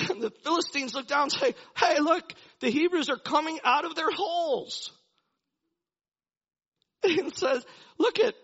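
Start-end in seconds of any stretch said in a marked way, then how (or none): 1.8-4.9 high, loud jeering lookout call; raised, carrying voice. Retold or imitated. Philistines calling out on spotting Hebrews. 8.0-8.3 calling out. Retold or imitated. Lookouts calling to each other.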